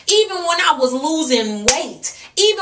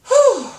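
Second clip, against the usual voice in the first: loud relieved exhale; 10 semitones higher, 4 dB louder than usual. Real, not acted. Vlogger to viewers after ranting, loud relieved whew. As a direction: blow out a high, loud whew. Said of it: "exhale after rant".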